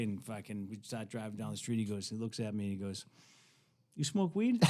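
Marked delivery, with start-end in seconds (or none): 4.0-4.6 high, rising, lightly voiced hippie; imitated sly question. Old hippie to a teen in the car, sly imitated question. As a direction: pitch it up as a sly offer.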